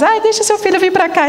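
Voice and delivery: mock parent voice. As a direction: lightly mimic a neighbour parent begging to send the kids. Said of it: "A gente recebe mensagem de uns cinco pais".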